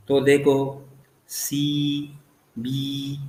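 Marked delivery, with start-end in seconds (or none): 1.3-3.2 सी and बी each drawn out as its own beat; both syllables stressed. Shown as a demonstration. Teacher showing a spondee, सी and बी both stressed. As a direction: give सी and बी equal, separate weight. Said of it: "ये दोनों stress है".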